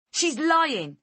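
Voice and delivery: a high, loud yell. Acted. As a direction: shout her down furiously. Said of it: "Angela yells".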